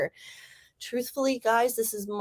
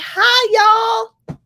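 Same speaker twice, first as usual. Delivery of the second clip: high, loud Southern greeting; Southern accent; 11 semitones higher, 10 dB louder than usual. Real, not acted. Greeting viewers after the sprint, loud Southern accent. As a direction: call out a Southern howdy to everyone. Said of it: "my California accent is turning into a Southern accent".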